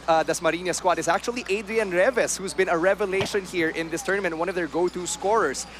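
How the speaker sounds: ordinary talk, nothing marked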